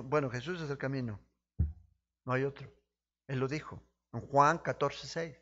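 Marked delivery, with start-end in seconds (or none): none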